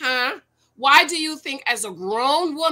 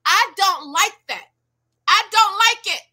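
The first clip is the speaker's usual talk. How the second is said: imitating Johnny; 8 semitones higher, 6 dB louder than usual. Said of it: "He's telling you".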